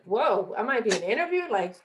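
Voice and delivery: flustered, caught off guard in an interview; mock alarm at a tough question. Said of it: "my emotions are kinda stirring up".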